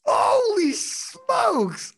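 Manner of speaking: high, very slow stunned exclaim; stunned exclamation. Real, not acted. Host hearing the gold medal was taken back, stunned and slow. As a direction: draw it out in amazement. Host reacting to the overturned call.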